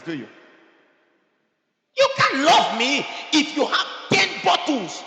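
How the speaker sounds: loud, high-pitched rebuke; full-force preaching. Real, not acted. Preacher challenges drinkers who claim love, full-force preaching. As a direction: shout it down at the congregation, insistent.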